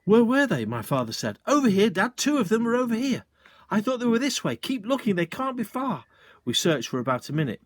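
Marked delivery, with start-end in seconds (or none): 0.1-0.6 eager. A narrator's reading. Father searching eagerly. 1.4-6.1 eager calling. A narrator's reading. Eager search in woods.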